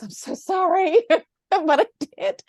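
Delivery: high, giggling apology; laughing confession. Real, not acted. Confessing to the host about sneaking out, laughing. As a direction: giggle through a guilty admission.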